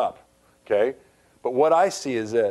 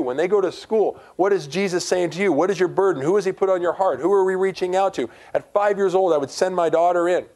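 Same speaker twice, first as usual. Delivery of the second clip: raised, high-pitched preacher's delivery; emphatic sermon, pitched up high; impassioned preaching; 7 semitones higher, 4 dB louder than usual. Real, not acted. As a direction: drive it home to the congregation. Preaches with rising force.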